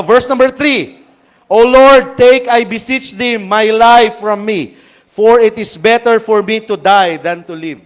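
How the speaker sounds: loud, slow proclaiming of the verse; forceful scripture reading. Acted. Preacher reading Jonah's prayer to the congregation, loud and slow. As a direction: read the plea of Jonah out to the whole church.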